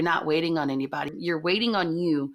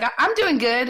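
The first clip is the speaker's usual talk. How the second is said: curt bitter reply; 7 semitones higher, 5 dB louder than usual. Retold or imitated. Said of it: "still a little bit bitter, a little bit angry".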